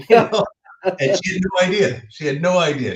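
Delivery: laughing through the words, high and loud. Real, not acted. Son laughing about his unaware mother, high laughing voice. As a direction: laugh as you tell how she had no idea. Laughing while retelling mom.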